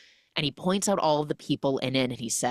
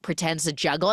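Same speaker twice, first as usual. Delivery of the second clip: usual speaking voice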